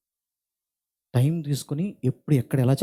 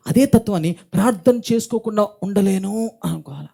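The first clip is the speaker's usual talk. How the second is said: louder and higher, driving the sermon home; preaching at full force; 5 semitones higher, 5 dB louder than usual. Acted. Pastor urging parents to be addicted to prayer, preaching at full force. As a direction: preach it hard, building pressure.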